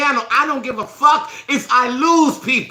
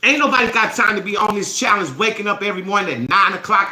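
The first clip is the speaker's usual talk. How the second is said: normal talking voice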